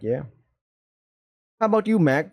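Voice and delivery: usual speaking voice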